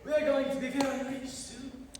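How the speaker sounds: hammed-up French chef accent; fake French accent. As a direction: lay the French accent on thick, like a cartoon chef. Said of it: "Why are you speaking in a German accent?".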